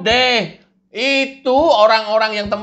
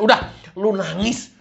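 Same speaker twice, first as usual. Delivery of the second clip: ordinary talk, nothing marked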